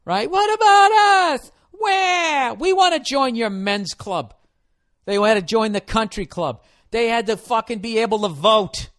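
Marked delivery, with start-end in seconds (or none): 0.3-4.3 much higher, louder shrill-mock shouting; mock complaining women. Comic mocking women demanding to join men's club, high shout. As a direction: whine and demand your way into the club. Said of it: "women had to come into every place".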